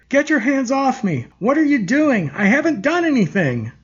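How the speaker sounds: high, frantic protest; crying out. Acted. Elizabeth, dragged from her chair, cries out high and frantic. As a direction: cry out in panic against the grip. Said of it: "Elizabeth cried out".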